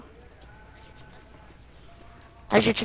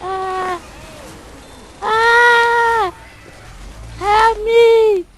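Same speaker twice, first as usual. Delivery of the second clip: crying for help; 9 semitones higher, much slower than usual. Real, not acted. Pinned, calling for help.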